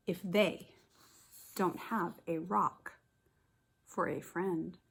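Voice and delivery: normal talking voice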